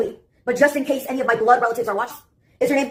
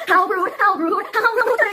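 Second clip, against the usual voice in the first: mock offended; 6 semitones higher, slower than usual. Mock offended mother character.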